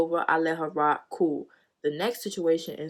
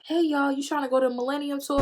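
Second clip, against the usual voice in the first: mock eager invite; 8 semitones higher than usual. Imitating friend's invite.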